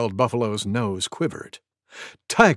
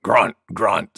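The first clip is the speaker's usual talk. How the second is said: low boar grunt, spoken as words; deep, piggy "grunt, grunt"; wild boar grunting; 6 semitones lower than usual. Acted. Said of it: "said Wild Boar".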